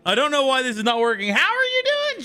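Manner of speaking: chipmunk-high streamer voice; high-pitched voice. Acted. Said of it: "the voice is real".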